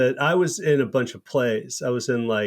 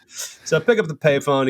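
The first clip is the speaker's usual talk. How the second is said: normal talking voice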